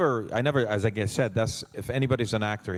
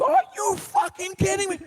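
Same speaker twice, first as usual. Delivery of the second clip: stunned disbelief; 5 dB louder than usual. Retold or imitated. Buddy even more stunned.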